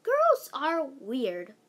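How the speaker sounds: slow, unimpressed deadpan; dry remark. Acted. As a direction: say it slowly, totally unimpressed.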